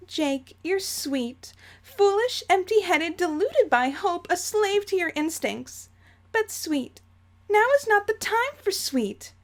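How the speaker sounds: high, condescending Umbridge-like lady; sweetly scornful matriarch, pitched high; Jane voice. Acted. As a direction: belittle him in a prim, high voice. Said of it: "my Jane voice is slowly turning into Dolores Umbridge".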